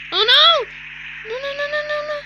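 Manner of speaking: high, loud panicked screaming. Real, not acted. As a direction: shriek no over and over in panic. Panicking as the house is destroyed.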